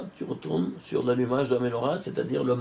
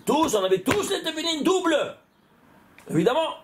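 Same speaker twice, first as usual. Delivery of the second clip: excited recollection; 11 semitones higher than usual. Retold or imitated. Recounting praying with the rabbis.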